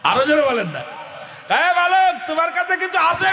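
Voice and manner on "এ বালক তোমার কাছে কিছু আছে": calling out. Acted. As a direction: call across to the boy. Robber calls to the boy.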